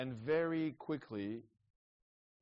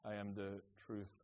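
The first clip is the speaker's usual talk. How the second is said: quoting Jesus; 4 semitones lower, 8 dB quieter than usual. Retold or imitated. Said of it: "Sorry, I said it in French".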